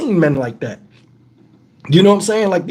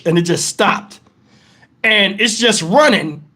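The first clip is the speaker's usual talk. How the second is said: normal talking voice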